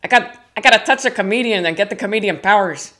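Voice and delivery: lightly voiced older cousin, quick; a hint of Ernie's eager voice; imitating Ernie. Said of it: "He has a voice like that".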